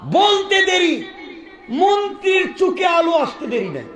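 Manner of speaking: high, ringing preacher's shout; rousing proclamation. Real, not acted. Preacher announcing the healed eyes, high rousing shout. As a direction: proclaim it high over the crowd.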